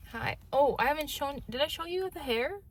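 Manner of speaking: ordinary delivery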